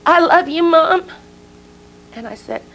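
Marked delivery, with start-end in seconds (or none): 0.0-1.0 put-on high, loud call of a little girl; child's yell. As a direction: call out to Mom from a distance. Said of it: "She yells from the porch".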